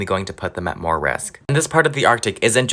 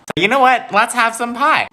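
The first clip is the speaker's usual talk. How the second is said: chirpy offer; 12 semitones higher than usual. Pretend. Cheerful pie offer.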